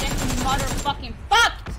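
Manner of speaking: loud trash-talk yell; forceful, loud swearing; gloating shout. Real, not acted. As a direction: yell it at a beaten opponent. Yelling at an opponent in game.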